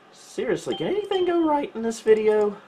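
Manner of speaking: usual speaking voice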